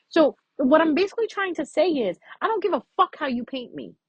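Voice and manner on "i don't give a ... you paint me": ordinary talk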